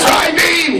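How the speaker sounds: high anime attack call; Tension Han impression. Actor acts the attack call in a high Tien voice. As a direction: shout the attack name like Tien. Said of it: "I'm like".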